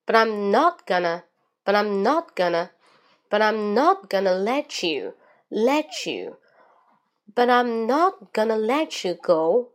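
slow waves, NOT and GO stressed, OWN rising then falling; linked medium-slow reading. Shown as a demonstration. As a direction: link "but I'm" and "let you", stretching the stressed words. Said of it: "纠准语音语调当中还有一个连读". (中速偏慢连读，not、go、own 重读，语调起伏)

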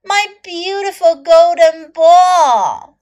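high, loud, slow princess lament; drawn-out wail of a storybook princess; cartoon princess. Princess laments her lost ball.

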